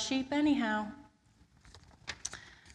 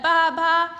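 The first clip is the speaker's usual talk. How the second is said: loud bleating baa; sheep bleating; 9 semitones higher, 13 dB louder than usual. Acted. Sheep noise.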